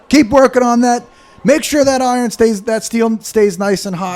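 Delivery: high, loud orders to workers; shouting orders. Acted. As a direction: bark orders across the forge. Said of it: "he's shouting orders".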